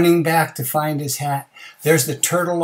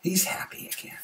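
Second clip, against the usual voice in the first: ordinary delivery, nothing marked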